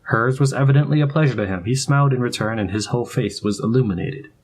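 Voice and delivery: usual speaking voice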